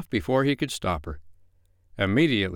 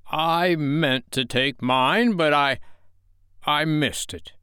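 high, slow, protesting alibi; feeble excuse; 6 semitones higher, 3 dB louder, slower than usual. A narrator's reading. Father caught dodging his medicine, protesting. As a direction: stretch out the excuse haltingly.

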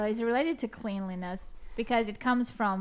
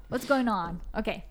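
ordinary talk

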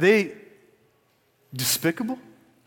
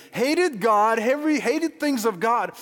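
normal talking voice

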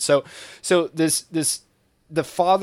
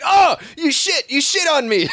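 mock shocked outburst; 12 semitones higher, 5 dB louder than usual. Father appalled at the corpse in the retold film, high mock outcry. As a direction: exclaim in hammed-up horror, breaking into laughter.

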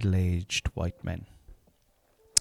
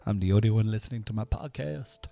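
ordinary talk, nothing marked

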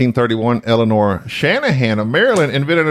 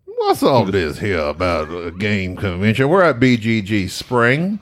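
low, slow Louisiana drawl; gravelly Louisiana accent; 5 semitones lower than usual. Pretend. Co-host opening a convention report, slow gravelly Louisiana accent. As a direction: rumble it slowly in mock Louisiana. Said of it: "you gotta do more of a".

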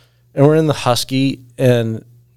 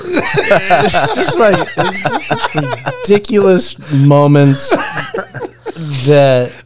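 laughing; 5 semitones higher, slower than usual. Real, not acted. Laughing through the story's end.

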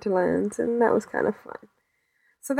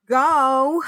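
exasperated urging; 5 semitones higher than usual. Acted. Mock urging a freed hostage.

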